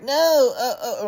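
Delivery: high-pitched fake whimper; mock whining mom. Son mimicking mom's refusal, high mock whine. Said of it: "my mom would be like".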